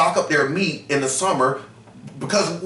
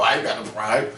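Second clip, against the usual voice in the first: lower, put-on voice brushing off pride; mock prideful denial; 7 semitones lower than usual. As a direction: play a proud brother waving it off, lower. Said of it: "that's how people are".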